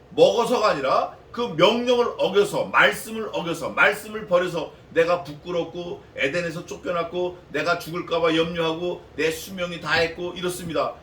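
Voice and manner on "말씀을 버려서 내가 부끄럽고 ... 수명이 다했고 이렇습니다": ordinary delivery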